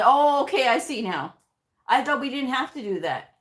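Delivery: sudden high, loud "oh" of realization; doctor's mock lightbulb moment, pitched high; provider realizing. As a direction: play the provider who finally gets it. Provider finally understanding.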